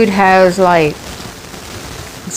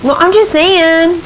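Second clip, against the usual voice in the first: high, slow, defensive; 11 semitones higher than usual. Retold or imitated. Telling her husband she's just saying, high and slow. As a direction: drag it out high, playfully defensive. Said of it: "I was like".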